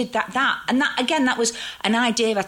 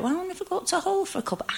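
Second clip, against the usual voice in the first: playful musing; 13 semitones higher, 4 dB quieter, faster than usual. Retold or imitated. Her playful inner thought.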